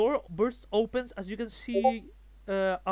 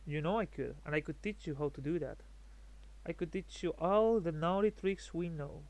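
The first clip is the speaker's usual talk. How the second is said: seductive purr; 3 semitones lower, 6 dB quieter than usual. Acted. Vampire seducing player.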